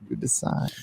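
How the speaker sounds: breathy whisper, trailing off; whispered aside; whispered echo. Pretend. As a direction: repeat it as a spooky whisper. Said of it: "repeated as a whisper".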